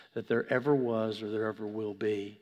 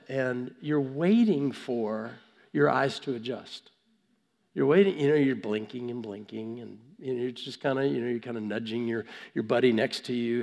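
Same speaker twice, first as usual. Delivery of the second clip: ordinary delivery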